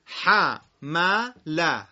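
«ح، م، ل» each as its own loud beat; syllables split for repeating. Shown as a demonstration. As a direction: model the beats for the class to repeat. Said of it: "تکرار کنید".

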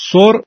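سر as "sor" with a short "o"; short rounded "o" in سر; short damma. Shown as a demonstration. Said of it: "ضمهٔ سین او هست و کوتاهه".

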